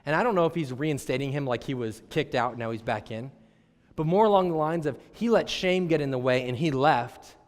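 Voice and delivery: ordinary talk, nothing marked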